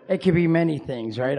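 ordinary delivery

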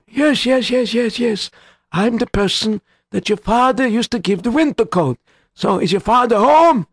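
put-on high eager old man; lightly voiced elderly visitor. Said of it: "a very old man".

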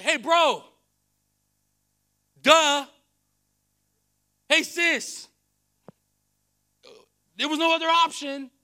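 high, loud comic exasperation; comical God. Acted. God teasing bro and sis, comical shouting. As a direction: call it out as an obvious truth. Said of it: "I feel God is a little comical".

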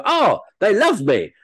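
high, slow gush; mock delight. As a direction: swoon with exaggerated relief at being wanted. Acts actor delighted at getting work.

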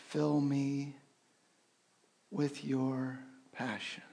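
slow, soft prayer with breath cues; hushed meditative prayer; guided breath prayer. Acted. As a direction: guide them through the breathing, softly.

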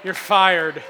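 loud, sudden joking outburst; mock firing. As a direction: boom out a pretend dismissal. Joke after wife told him to stop.

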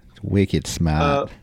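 put-on drawn-out Boston accent; slow, playful Boston accent echo. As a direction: stretch it slowly in mock Boston. Echoes co-host's Boston accent line.

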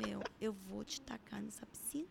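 hushed whisper, voiceless and soft; exhausted mom whispering a mock threat. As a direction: breathe the threat out under your breath. Mother exhausted, mock threat to baby.

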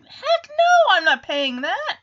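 high, drawn-out outraged protest; indignant refusal. Real, not acted. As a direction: pitch jumps up in disbelief. Host balks at the price.

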